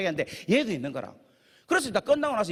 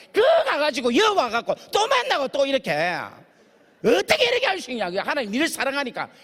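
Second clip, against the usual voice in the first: higher, louder impassioned preaching, near tears; fervent preaching; 6 semitones higher, 4 dB louder than usual. Acted. Preacher retelling his plea to the cellmate, impassioned crying sermon. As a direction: preach it with rising passion, voice cracking.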